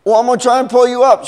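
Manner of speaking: high, loud, hurried call; urgent shouting. Acted. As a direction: shout down in a rush. Acted shouting between the two women.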